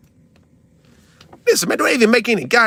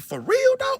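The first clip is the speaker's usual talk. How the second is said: incredulous; 4 dB quieter, slower than usual. Retold or imitated. Retold reaction, disbelief.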